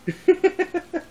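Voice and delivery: short high giggle; high laugh. Real, not acted. Vlogger giggling to viewers, high and short.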